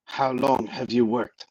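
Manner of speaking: insistent, badly faked French accent. Felix warns her before things get rough, bad French accent. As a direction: press the question in a hammy French accent. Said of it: "in a very bad French accent".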